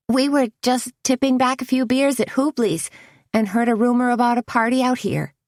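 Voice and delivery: high, quick playing dumb; breezy cover story; goofy fake-innocent. A narrator's reading. Tony playing innocent, grinning.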